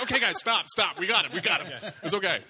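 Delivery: put-on laughing shout to the kids; laughing call to stop. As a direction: break up the kids while cracking up. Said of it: "I'm like".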